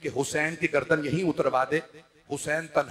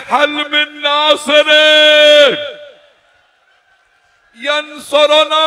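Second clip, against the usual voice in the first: high, slow anguished cry, much louder; 10 semitones higher, 14 dB louder, much slower than usual. Acted. Lone Imam crying for helpers, anguished slow cry. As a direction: wail the call for help at full force. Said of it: "चीख चीख के कह रहे".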